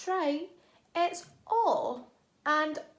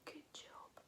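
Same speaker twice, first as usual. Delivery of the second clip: whisper; 27 dB quieter than usual. Shown as a demonstration. Teacher stays in her whisper praising the pupils. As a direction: still whispering, unvoiced. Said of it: "whisper voice".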